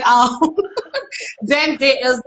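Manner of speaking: ordinary talk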